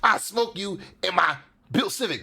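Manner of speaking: high-pitched mocking imitation; boastful car guy. Reactor imitates built Civic owners bragging, mocking voice. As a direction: put on a silly boaster's voice. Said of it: "people be like".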